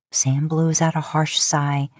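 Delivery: ordinary delivery, nothing marked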